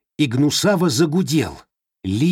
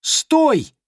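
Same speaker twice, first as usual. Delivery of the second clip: put-on high, sharp boy's shout; shouted stop; 3 dB louder than usual. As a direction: yell to stop her. (резкий крик мальчика)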